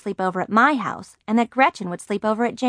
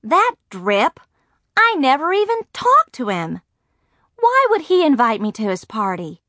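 higher, indignant disbelief; indignant yelling; 6 semitones higher, 4 dB louder than usual. A narrator's reading. Margaret reacts to Norman's invitation, indignant. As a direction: protest in mild outrage. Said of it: "I yelled".

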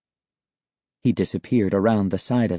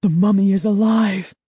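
terrified; 9 semitones higher, 4 dB louder than usual. Acted. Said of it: "The horrifying thought swept through".

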